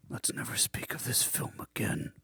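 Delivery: hushed, voiceless whisper. Retold or imitated. Friend leaving the cinema, whispering to the group. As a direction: breathe it like a grim secret. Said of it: "He said".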